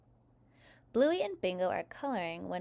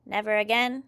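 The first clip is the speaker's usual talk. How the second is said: louder monotone robot; robot question; 7 dB louder than usual. A narrator's reading. Robot questions the children's order, loud monotone. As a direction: query it like a machine processing an order. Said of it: "asks Daddy Robot, recalculating objectives".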